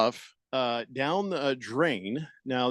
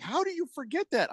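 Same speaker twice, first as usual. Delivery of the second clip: exasperated wife; 14 semitones higher than usual. Retold or imitated. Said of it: "And she's like".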